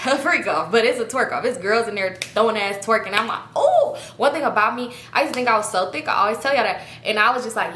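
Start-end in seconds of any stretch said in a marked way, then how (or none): 3.6-4.0 much higher, louder delighted oh; excited oh. Real, not acted. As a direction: squeal at seeing the twerk off. Spotting the twerk off.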